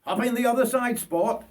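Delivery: high, bright broad Australian call; cheery old Bert Booth. Acted. Old bushman telling Richard to get in, high and cheery. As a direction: wave the lad into the ute. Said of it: "he said".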